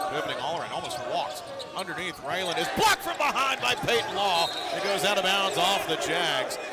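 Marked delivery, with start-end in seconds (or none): 1.8-6.6 louder, higher burst of play-by-play; excited call. Real, not acted. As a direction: call the big block with a jolt of excitement. Live call of a blocked shot.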